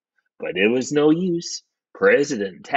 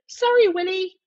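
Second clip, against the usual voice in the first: very high falsetto apology; high-pitched wifely apology; apologetic wife. Acted. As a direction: apologise in a piping wife's voice. Wife apologises, then sniffs.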